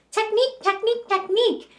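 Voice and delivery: high-pitched mock parroting; hammed-up imitation of chattering students; mimicking peers.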